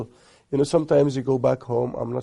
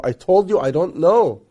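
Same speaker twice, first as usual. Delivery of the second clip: louder, higher exasperated wife; retold getting mad; 4 semitones higher, 7 dB louder than usual. Wife answering husband who insists, mock mad. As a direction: imitate a wife losing patience. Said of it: "and then she gets mad".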